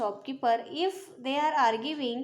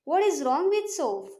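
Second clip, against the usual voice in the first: a rude, higher, louder retort; 5 semitones higher, 5 dB louder than usual. Acted. Shopman rudely dismissing the complaint, rude retort. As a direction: snap back at the customer. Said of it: "very rudely he is saying it".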